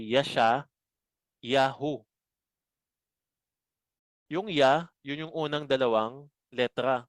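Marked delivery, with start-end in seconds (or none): 0.1-2.1 "Yasha, Yahu" in separate parts, ya-sha, ya-hu; Hebrew reading. Shown as a demonstration. Teacher reads out Isaiah's Hebrew name, "Yasha, Yahu" in two parts. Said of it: "Ang basa sa kanya ay".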